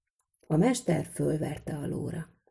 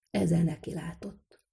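ordinary delivery

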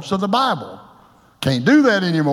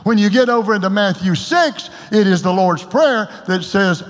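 ordinary delivery

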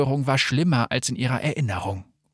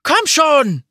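high, loud desperate scream; 7 dB louder than usual. A narrator's reading. Someone digging through rubble, screaming loudly in despair. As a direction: scream it out at full voice. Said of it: "schrie jemand".

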